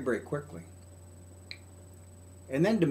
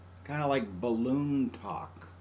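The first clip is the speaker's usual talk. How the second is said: normal talking voice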